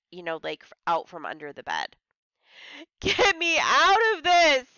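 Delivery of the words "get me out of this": high, loud, drawn-out bawl. Acted. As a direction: bawl it in a panic. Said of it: "I bawled".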